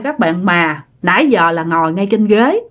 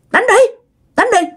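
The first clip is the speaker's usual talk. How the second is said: shouted dare; 12 semitones higher than usual. Retold or imitated. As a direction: bark the dare to fight. Ghost daring the exorcist to fight.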